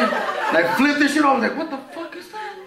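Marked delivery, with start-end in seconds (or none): 1.6-2.5 high, soft disbelieving question; mock bewilderment, pitched up and hushed; retold shock. Retold shock at seeing shadows.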